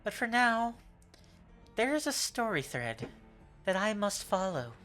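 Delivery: smooth, theatrical rogue; suave villain voice. Acted. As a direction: purr it like a charming trickster. NPC speaks to party.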